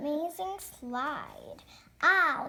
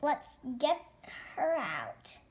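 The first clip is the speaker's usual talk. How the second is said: ordinary talk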